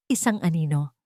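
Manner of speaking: plain reading voice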